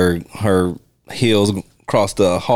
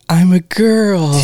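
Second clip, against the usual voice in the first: high, slow, delighted imitation; hammed-up coy delight; mock gleeful; 9 semitones higher, 5 dB louder, slower than usual. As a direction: purr it out like a giddy diva. Quoting a gleeful line.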